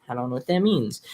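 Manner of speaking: usual speaking voice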